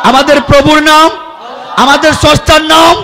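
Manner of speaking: call-and-response, rising to a shout; building call-out. Real, not acted. Preacher calling out to crowd.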